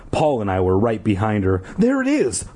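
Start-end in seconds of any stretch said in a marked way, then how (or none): 1.8-2.4 high excited shout. A narrator's reading. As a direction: call it out, pointing ahead. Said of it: "Paul shouted".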